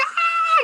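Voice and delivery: high-pitched mock scream of fright; a sudden squeal of fear. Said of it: "he's like".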